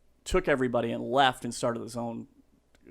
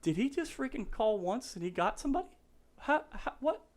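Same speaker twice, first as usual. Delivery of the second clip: stunned disbelief; 12 semitones higher, 4 dB quieter than usual. Retold or imitated. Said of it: "looking at me like".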